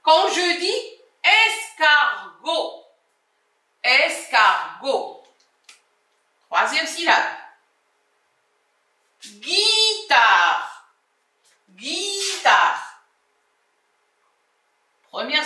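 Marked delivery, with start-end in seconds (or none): 1.2-5.2 "escargot" broken into es, car, go; teacher's careful split of "escargot", syllable by syllable. Shown as a demonstration. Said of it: "la syllabe dans laquelle tu entends". 9.2-13.0 "guitare" stretched into gui and tare; teacher sounding out "guitare" in two long syllables; drawn-out syllables. Shown as a demonstration. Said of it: "la syllabe dans laquelle tu entends".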